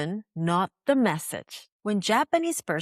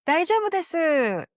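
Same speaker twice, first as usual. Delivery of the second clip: smiling reassurance; 8 semitones higher than usual. Shown as a demonstration. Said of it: "と、笑顔で言われたら".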